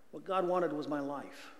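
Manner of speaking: ordinary talk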